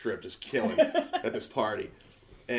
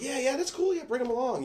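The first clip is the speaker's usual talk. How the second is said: high-pitched, breezy fake cool; airy, too-casual agreement; feigned nonchalance; 14 semitones higher than usual. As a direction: play it off like you don't care, voice way up high. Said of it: "played it off like I didn't care".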